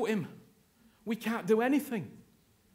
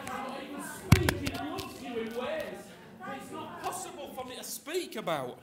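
awed hush; 3 semitones higher, 8 dB quieter than usual. Real, not acted. Awed hush about God's love.

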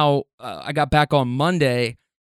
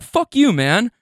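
retold retort; 7 semitones higher than usual. Snaps back again.

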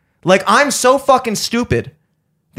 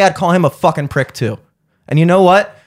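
ordinary talk, nothing marked

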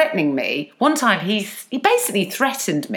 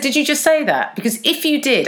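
higher-pitched retold confrontation; mock loud challenge; 6 semitones higher than usual. Worker calls out bully in open office, higher mock challenge. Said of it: "repeated back what he said really loudly".

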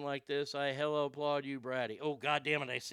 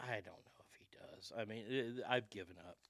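whispering; 6 semitones lower, 10 dB quieter than usual. Real, not acted. Giving up on the son.

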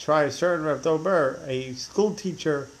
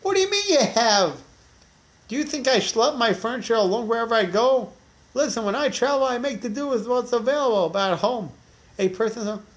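indignant villager; 7 semitones higher than usual. Retold or imitated. Villager keeps arguing with rabbi.

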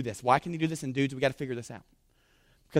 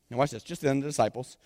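normal talking voice

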